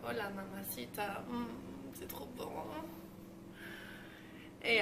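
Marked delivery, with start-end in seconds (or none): none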